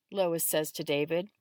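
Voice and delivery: ordinary delivery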